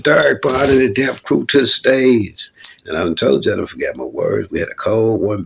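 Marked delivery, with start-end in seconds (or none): 0.0-2.5 higher, slow announcer call; stretched emcee announcement. Retold or imitated. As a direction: announce the act to the crowd. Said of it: "they said".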